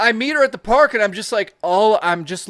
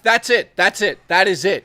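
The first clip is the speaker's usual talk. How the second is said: mock triumphant; 5 dB louder than usual. Retold triumphant declaration.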